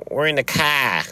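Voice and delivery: high, exaggerated Boston accent; mock a Bostonian dropping the R in "car"; hammed-up Boston accent. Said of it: "See, in Boston, they're like".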